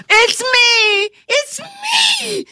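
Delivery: high, loud joyful shout; exultant shout. Acted. Man freed from the spell tells Kate, exultant high shout. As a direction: cry out overjoyed at being himself. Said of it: "he shouted exultantly".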